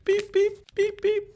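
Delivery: high electronic beep imitation; mock beeping. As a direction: chirp like a timer going off. Transition beep sound effect.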